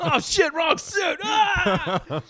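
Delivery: high panicked yell, laughing; panicked shout. Pretend. Retold panic in wrong suit.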